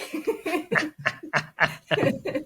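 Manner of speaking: bright rising laugh. Real, not acted. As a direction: laugh at her own joke. Laughing at her own joke.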